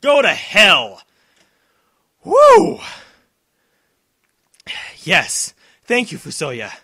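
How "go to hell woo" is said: slow, loud taunt then whoop; triumphant shout. Real, not acted. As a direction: spit it at the enemy. Final hit celebration.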